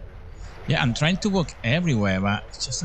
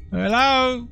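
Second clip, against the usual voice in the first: mock calling out; 12 semitones higher than usual. Gamer role-playing entering the house, a hammed-up calling out. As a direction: play the cheery spouse coming home.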